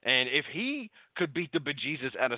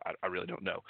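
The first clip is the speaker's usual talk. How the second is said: ordinary delivery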